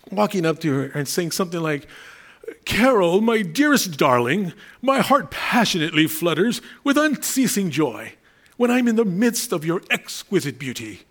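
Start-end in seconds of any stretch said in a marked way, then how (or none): 2.7-11.0 mock-romantic flowery declaration, slowed on the endearments; lightly voiced, theatrical sweet-talk, a touch higher; over-the-top flattering suitor. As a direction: ham up a lovesick poet wooing his wife. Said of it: "flattering words".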